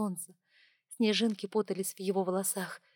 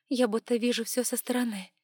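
ordinary delivery

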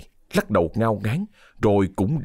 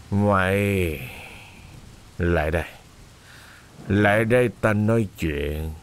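low, slow lecherous drawl; slurring drunk landlord calling the servant girl; drunk master's leer; 4 semitones lower, slower than usual. A narrator's reading. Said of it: "Ông lè nhẹ".

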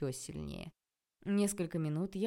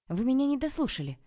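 louder, higher, indignant protest; getting angry; 8 semitones higher, 6 dB louder than usual. A narrator's reading. Man protesting he was not heard out, getting angry. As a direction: start losing your temper at being interrupted. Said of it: "начал сердиться Анатолий".

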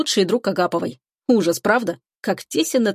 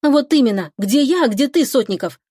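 higher-pitched, heated comeback; indignant retort; 5 semitones higher, 3 dB louder than usual. A narrator's reading. Girl returning the man's insult, indignant. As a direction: throw his words back at him.

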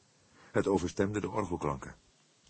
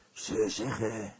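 panting; 4 semitones higher, much slower than usual. A narrator's reading. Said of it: "hijgde hij".

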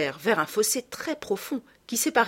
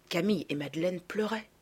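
plain reading voice

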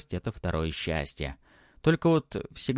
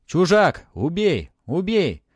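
high, loud malicious cries; vicious squealing; 9 semitones higher, 7 dB louder, slower than usual. A narrator's reading. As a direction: spirits shrieking for a kill. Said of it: "Духи злобно завизжали".

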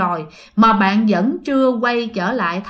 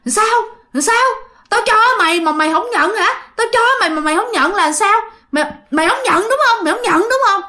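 put-on menacing old woman; 10 semitones higher than usual. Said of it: "gương mặt bà trông rất là dữ". (giọng bà già hung dữ, cao và to, đe dọa)

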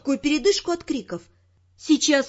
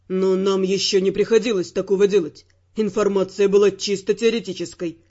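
much lower male voice, calm and grim; calm, gloomy Ferrant; 7 semitones lower than usual. Acted. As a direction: answer steadily, darkly. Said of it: "говорил намного более спокойно, но ... мрачно".

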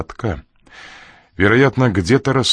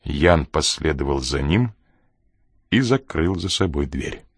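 ordinary delivery

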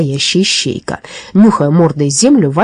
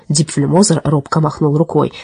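plain reading voice

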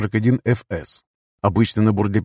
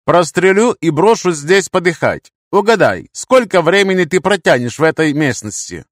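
loud, high interrogation threat; forceful gunpoint intimidation; menacing threat; 8 semitones higher, 6 dB louder than usual. Acted. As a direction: press the captive with a raised voice. Threatening captive during interrogation.

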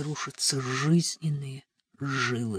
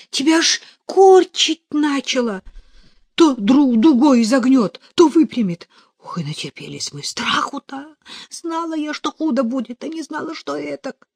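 put-on high, agitated peasant girl voice; agitated recounting; 9 semitones higher, 7 dB louder than usual. A narrator's reading. Parasha telling how scared they were, high and agitated. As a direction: pour it out high and shaken. Frightened girl retells the fit.

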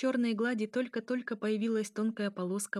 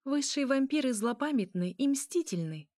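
higher, lively declaration; lightening the mood; 5 semitones higher, 3 dB louder than usual. A narrator's reading. Elya tries to ease the tension, lightly. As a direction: say it playfully with lifted pitch. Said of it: "пытаясь разрядить обстановку".

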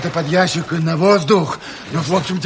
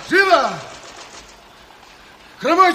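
loud, high commanding yell; shouted orders; 9 semitones higher, 3 dB louder than usual. Acted. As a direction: shout over the chaos. Командир кричит приказы в суматохе. (громкий окрик, выше)

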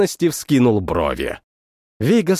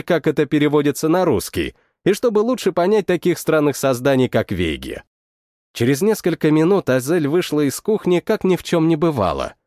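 ordinary delivery

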